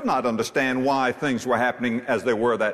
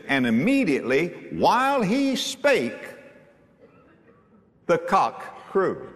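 scripture reading; 2 semitones higher than usual. Acted. Preacher reads scripture aloud.

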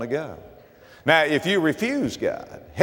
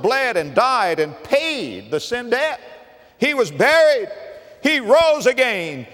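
higher, louder, slowed pulpit build; preaching at full force; 9 semitones higher, 3 dB louder than usual. Acted. Preacher proclaiming Christ's death and resurrection, slow and raised. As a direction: proclaim each line like a climax.

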